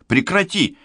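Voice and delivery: high, alarmed command; urgent high-pitched cry; anxious exclamation. A narrator's reading. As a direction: order them to stop, voice leaping up in alarm. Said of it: "тревожно восклицает Денис".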